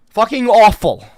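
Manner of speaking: ordinary talk, nothing marked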